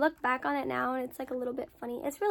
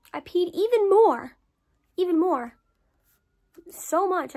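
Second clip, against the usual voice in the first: loud and high, disbelieving; incredulous; 6 semitones higher, 9 dB louder than usual. Retold or imitated. Telling viewers about the hospital bathroom, loud disbelief. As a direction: shout out the absurdity.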